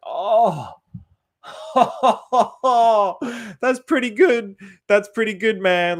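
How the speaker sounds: big high laugh into impressed praise; gleeful, laughing amazement; delighted laughing. Retold or imitated. Acts delighted reaction to huge profits.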